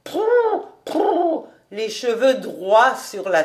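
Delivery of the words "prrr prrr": high, blown lip trill; flustered lip trill. A narrator's reading. Fritz covering his blush, high blown prrr. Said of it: "en soufflant dans ses joues et criant".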